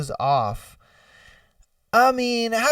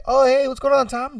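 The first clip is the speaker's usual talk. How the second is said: higher-pitched casual hello, acted; casual greeting; 7 semitones higher than usual. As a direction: play someone making small talk. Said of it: "try to have a casual conversation".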